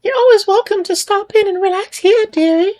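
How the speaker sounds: very high strained falsetto lady; a very high, mocking female villager voice; bad female voice. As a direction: squeeze up into a shrill granny. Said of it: "a voiceover for my female characters".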